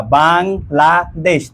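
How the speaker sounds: "Bangladesh" slowly as bang, la, desh; split into syllables. Shown as a demonstration. Teacher confirming the count of three, "Bangladesh" syllable by syllable. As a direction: draw out each syllable of "Bangladesh". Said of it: "তিনটা".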